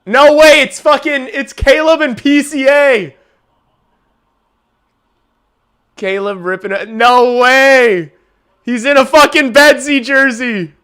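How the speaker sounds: high, loud thrilled disbelief; can't believe what he's seeing; excited. Real, not acted. Streamer spotting fans in jerseys on the broadcast, thrilled.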